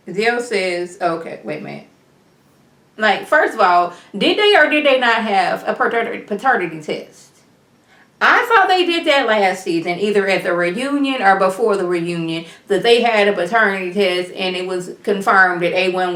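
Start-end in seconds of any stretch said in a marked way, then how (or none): none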